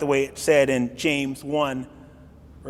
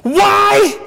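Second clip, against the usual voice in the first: loud, high, crying why; anguished shout; 12 semitones higher, 11 dB louder, much slower than usual. Retold or imitated. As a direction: wail it out in anguish. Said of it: "I can hear Peter shouting".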